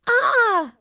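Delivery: sudden high startled scream. Acted. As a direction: shriek in fright. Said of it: "Amaya screams".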